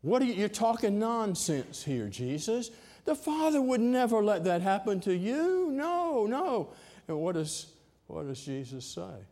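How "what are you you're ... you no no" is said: protesting rebuke. Acted. Peter rebukes Jesus.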